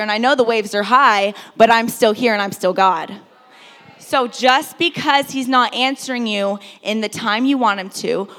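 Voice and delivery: loud, rising preaching voice; preaching at full force. Real, not acted. Preacher proclaims Jesus knew the storm, at full force. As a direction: build it up and proclaim.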